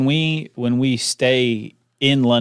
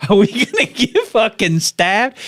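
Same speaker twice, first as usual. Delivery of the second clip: mock panic; 10 semitones higher, 4 dB louder, slower than usual.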